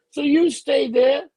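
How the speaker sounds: each word slow and loud; slow firm order. Retold or imitated. Telling the bar stranger to stay put, slow and firm. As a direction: lay down the law, slowly.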